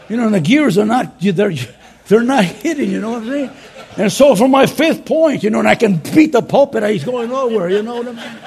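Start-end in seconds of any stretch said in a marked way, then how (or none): 4.0-8.5 talking through laughter; laughing. Real, not acted. Pastor laughing at his own stalled sermon, talking through laughter. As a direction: laugh at the sermon going nowhere.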